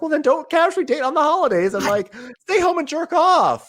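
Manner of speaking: high, loud mocking comeback; sarcastic retort. Friend mocks the boyfriend's dating complaint, high and sarcastic. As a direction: throw it back at him, high and snarky. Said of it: "I'm like".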